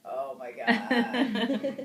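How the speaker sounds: exasperated groan. Retold or imitated. Young co-star groaning at his mom's pedicure, drawn-out and loud. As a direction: stretch it out like a bored kid stuck at the salon. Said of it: "he was just like".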